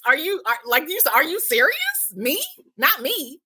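acted-out shock, voice jumping up; stunned disbelief. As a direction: react in disbelief to shocking news. Recounts her shock at being fired.

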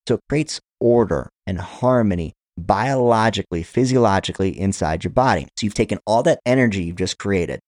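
ordinary talk, nothing marked

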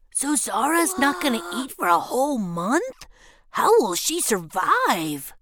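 high, put-on dismayed child voice; dismayed little brother. A narrator's reading. Brother fearing sister won't eat, dismayed. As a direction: cry out in worried disbelief. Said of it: "he cried".

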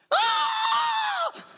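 high-pitched mock scream; sustained comic shriek; panicked scream. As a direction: scream as if losing your mind over nothing. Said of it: "They just lose their mind".

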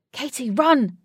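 loud, urgent warning shout. Acted. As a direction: yell at her to run. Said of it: "shouted Wraith".

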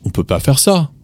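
"On peut pas faire ça" with a clear "on"; on version. Shown as a demonstration. Teacher giving learners the natural "on" form. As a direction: open on "on", relaxed. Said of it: "you would say on".

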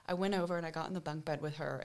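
ordinary talk

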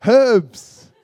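"Herbs" with a clearly sounded h; loud, high "Herbs", h breathed out hard; British pronunciation. Shown as a demonstration. Brit correcting Americans, "Herbs" said loudly with its h. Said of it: "And we say".

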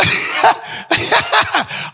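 breathless laughing; real laugh; airy high laugh. Real, not acted. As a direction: wheeze with laughter.

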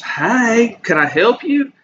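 high-pitched, effeminate server greeting a customer; amateur mock-feminine man, high and lilting; feminine dude voice. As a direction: imitate a feminine man, voice pushed way up. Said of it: "I don't have really good feminine dude voice".

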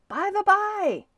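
high, loud call across the water; yelling. A narrator's reading. Man on shore calling to the departing sailor, yelling. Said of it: "yelled the man".

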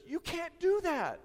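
sudden high, loud cry; aghast shopkeeper yelps; horrified shock. Acted. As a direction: blurt it out in horror. Joke punchline, watchmaker horrified.

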